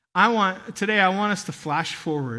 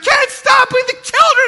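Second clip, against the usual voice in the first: much higher, louder frantic wife voice; panicked wife; 10 dB louder than usual. Retold or imitated. Wife protesting to her husband in the crowd, high frantic cry. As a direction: play the alarmed wife, pitched way up.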